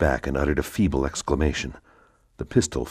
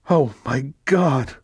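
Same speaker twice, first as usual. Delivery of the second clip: higher, louder, slow gasp of terror; terrified; 11 semitones higher, 5 dB louder, slower than usual. Acted. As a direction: horror dawning as she reads. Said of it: "read it in terror".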